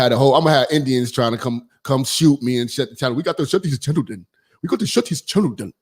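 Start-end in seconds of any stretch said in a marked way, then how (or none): 3.2-5.7 quick mock Indian accent that slips toward African; botched Indian accent. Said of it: "that's not an Indian accent".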